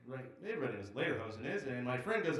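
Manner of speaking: ordinary talk, nothing marked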